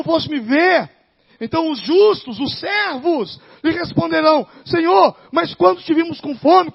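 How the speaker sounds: loud, high, impassioned pulpit voice; sustained loud sermon, slowing on the last words; full-force preaching. Acted. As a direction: thunder the scripture at the church.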